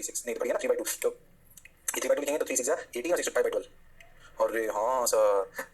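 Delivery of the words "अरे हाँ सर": low, very slow student reply; student chorus. Acted. Teacher voices the class agreeing, low slow chorus. Acts the class answering him.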